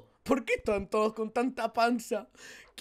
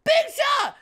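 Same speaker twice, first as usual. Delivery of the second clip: excited shout; 12 semitones higher, 6 dB louder than usual. Real, not acted. Finds pizza in game.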